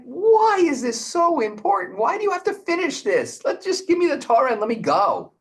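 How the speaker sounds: high, fast, exasperated pleading; a hint of a whiny, hurried Moses; impatient Moses. Moses pressing God to hand over the Torah, high and exasperated.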